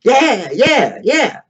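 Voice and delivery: high mock crowd yeahs; mock crowd cheer. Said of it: "people would grab onto that".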